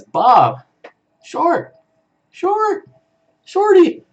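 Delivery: high-pitched exclaimed names, one at a time; mock calling out. Streamer acting out a viewer's chat message, mock high-pitched calling. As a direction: cry each name out in a high, squeaky-high voice.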